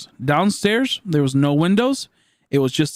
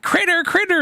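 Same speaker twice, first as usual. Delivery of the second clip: much higher, squeaky singsong critter call; imitating grandma as a buzzing fly; dream fly grandma. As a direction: squeak it like a tiny fly with a big head. Fly-grandma chases her in dream.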